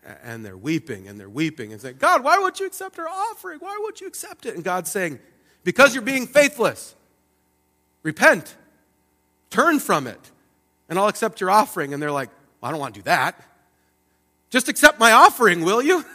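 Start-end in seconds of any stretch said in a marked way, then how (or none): 2.0-4.6 much higher, pitiful plea to God; a wailing voice asking why; whining pleading people. Acted. 5.6-11.9 stern loud God. Acted. God answers, commanding repentance. 12.6-16.0 whiny refusal. Acted. People refuse, whiny demand.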